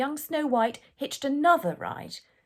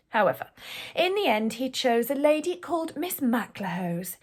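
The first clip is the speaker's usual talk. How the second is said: ordinary delivery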